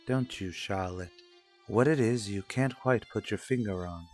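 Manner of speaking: ordinary delivery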